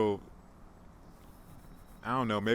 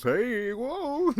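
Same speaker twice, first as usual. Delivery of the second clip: sudden high yelp of surprise; squeaky startled hey whoa; 13 semitones higher, 4 dB louder than usual. Real, not acted. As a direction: jump at something unexpected.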